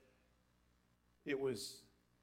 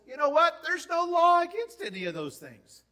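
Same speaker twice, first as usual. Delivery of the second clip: much higher and louder, proclaiming; triumphant proclamation; 14 semitones higher, 15 dB louder than usual. Retold or imitated. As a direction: announce it like the best news ever. Preacher quoting Paul triumphantly.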